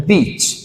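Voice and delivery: बीच with a lengthened ee; long vowel. Shown as a demonstration. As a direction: draw out the ee in बीच. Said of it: "large या long sound में".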